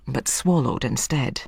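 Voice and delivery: usual speaking voice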